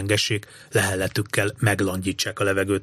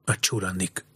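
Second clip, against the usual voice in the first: low, softened warning; understated hiss; 3 semitones lower, 6 dB quieter than usual. A narrator's reading. Alex warns the boys about the soldiers, lightly hissed. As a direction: drop the voice for a hushed alert. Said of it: "sziszent fel Alex".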